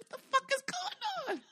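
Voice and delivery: very high, laughing disbelief; high shocked squeal. Retold or imitated. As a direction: squeal it like you can't believe it. Shocked by the punch.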